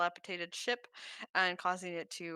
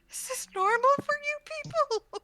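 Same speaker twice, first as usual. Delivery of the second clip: squeaky dwarf; 6 dB louder than usual. Acted. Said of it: "they're like".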